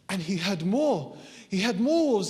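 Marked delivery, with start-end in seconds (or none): none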